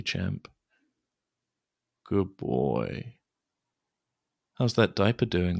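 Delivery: ordinary delivery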